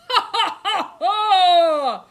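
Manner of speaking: loud, high booming laughter; roaring giant laugh. A narrator's reading. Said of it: "roared the giant".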